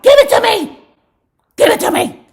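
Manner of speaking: high, very loud shriek; screaming demand. Retold or imitated. [name] screaming at [name] for it, very loud and high. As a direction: scream it like a woman with a razor. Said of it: "It was like".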